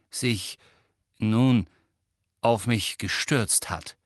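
usual speaking voice

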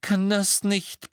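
high, slow, pleading plea; a mother pleading with her son. Acted. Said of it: "mit einem flehenden Blick".

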